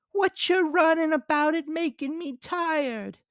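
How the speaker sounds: high, loud cartoon grasshopper; bright piping insect voice. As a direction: squeak it out as the carefree grasshopper. Grasshopper character voice.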